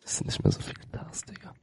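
quick secretive whisper; hushed rapid aside. Real, not acted. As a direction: whisper it fast so others miss it. Sudden aside to teammate.

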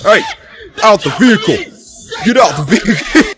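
loud, high police-style order; shouted command. Pretend. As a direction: yell it as an urgent order. Ordering a player out of car.

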